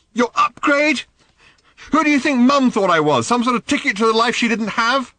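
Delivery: high, loud furious challenge; angry demand. Acted. Daughter demanding angrily of her father. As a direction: spit the question at him. Random raging at Arthur.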